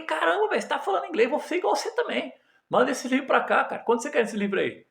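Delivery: high, laughing amazement; amazed excitement. Retold or imitated. Telling his friend he'll learn English, laughing in high amazement. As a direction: gush with wonder as he laughs.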